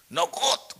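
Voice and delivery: high, pleading imitation; mock tearful complaint. New convert calling his pastor from Hawái, mock tearful complaint. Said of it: "y se puso a llorar".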